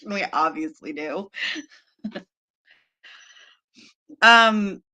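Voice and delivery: ordinary delivery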